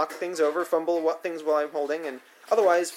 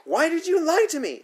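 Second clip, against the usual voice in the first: much higher, louder outraged question; shrill furious repeat; 14 semitones higher, 5 dB louder than usual. A narrator's reading. Sitter repeating her demand to the boy, furious high. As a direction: push it even higher, livid. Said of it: "she asks again".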